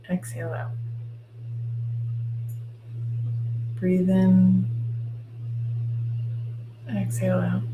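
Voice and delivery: soft, slow guided meditation voice; right in your ear. Acted. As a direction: ease them into calm, gently and slowly. Leads a guided visualization.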